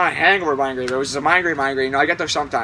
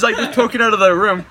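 ordinary talk, nothing marked

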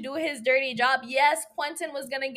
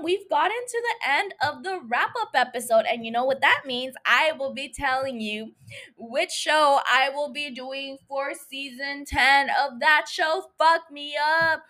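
normal talking voice

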